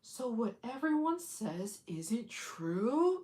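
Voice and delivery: slow, hushed whisper; whispered realization. Retold or imitated. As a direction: whisper it like a revelation. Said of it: "You'll be like".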